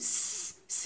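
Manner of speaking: স as a light, sustained hiss; thin S sound. Shown as a demonstration. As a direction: hold a plain thin স with no vowel. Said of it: "এভাবে শব্দ করবেন".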